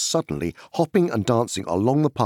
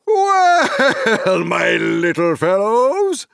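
put-on high, loud, hearty old rustic; cheerful booming country voice; jolly Tom Bombadil; 13 semitones higher, 8 dB louder, much slower than usual. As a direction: boom like a merry forest master.

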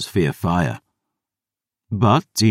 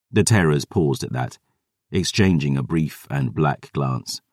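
ordinary delivery, nothing marked

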